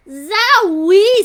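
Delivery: high, loud rising zowie; surprised exclamation. A narrator's reading. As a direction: yelp it in shock at the smell. Ada reacts to the stench.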